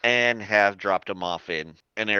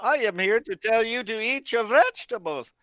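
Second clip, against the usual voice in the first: high, loud cartoon voice for the intro; silly [name]; 14 semitones higher, 4 dB louder than usual. Doctor introduces himself, high hammed-up cartoon voice. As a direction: ham up the name like a cartoon. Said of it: "in all seriously".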